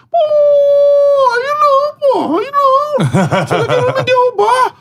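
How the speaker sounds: very high, exasperated mock coach voice; high-pitched indignant coach impression; Jair complaining. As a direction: squeal it like an offended coach.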